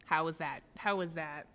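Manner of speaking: ordinary talk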